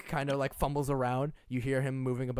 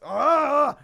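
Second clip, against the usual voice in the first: loud, high "Ah!" of pain; orc's pained cry; 11 semitones higher, 11 dB louder than usual. Acted. Orc hit by the bell's toll, loud pained cry. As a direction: yelp as a sudden noise hits the ear. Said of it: "that he kinda goes".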